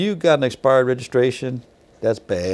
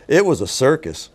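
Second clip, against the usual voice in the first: usual speaking voice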